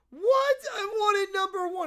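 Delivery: high, loud shriek into a whine; hammed-up tearful child's shout; bratty outrage. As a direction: cry out like a spoiled kid. Bratty contestant loses top answer.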